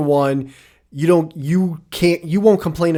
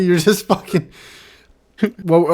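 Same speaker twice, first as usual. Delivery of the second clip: usual speaking voice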